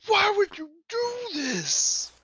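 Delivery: high, drawn-out anguished yell; so mad. Acted. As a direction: scream it slowly at your captors. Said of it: "he's just so mad".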